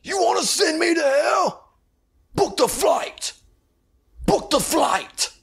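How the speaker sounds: very high hammed-up yell, slow on book the flight; mock shout. Quoting a favorite movie line to the viewer, high mock shout. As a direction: bellow it like a cheesy action hero. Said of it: "one of my favorite lines".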